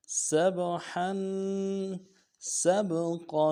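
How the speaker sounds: رواں تلاوت; fluent recitation. Shown as a demonstration. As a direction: recite the lesson words with a lilting chant. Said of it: "اب پورے سبق کو رواں پڑھتے ہیں".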